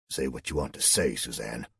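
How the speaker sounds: low, clipped-short irritation; testy. A narrator's reading. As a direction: colonel snapping lightly at his officer. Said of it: "the colonel replied almost testily".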